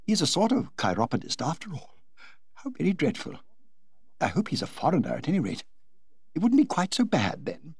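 a private horrified aside; the Duchess fretting to herself about the chiropodist. Acted. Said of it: "said the Duchess to herself".

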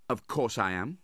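haughty. A narrator's reading. Nephew insists he will work, haughty. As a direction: answer proudly, as if insulted. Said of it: "replied Nicholas haughtily".

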